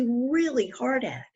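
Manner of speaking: plain reading voice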